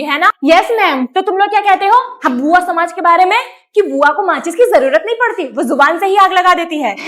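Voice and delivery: loud, nagging aunt playing teacher; loud bossy aunt. Bua lecturing students about how aunts are treated, loud and nagging. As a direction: scold the class like a meddling aunt.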